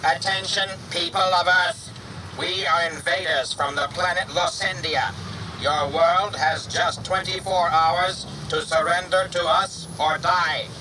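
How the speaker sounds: menacing robotic invader announcement; metallic alien voice. A narrator's reading. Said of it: "a strange metallic voice".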